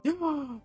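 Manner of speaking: high, excited gasp; delighted gasp. Real, not acted. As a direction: gasp at a treat. Delighted by chocolate strawberries.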